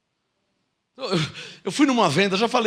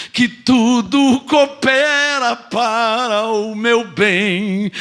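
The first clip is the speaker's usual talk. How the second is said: slow, louder grateful declaration; awed confession; 4 dB louder, much slower than usual. Retold or imitated. Believer overwhelmed by Christ's love, slow and loud. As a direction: draw out each word in wonder. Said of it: "você vai falar assim".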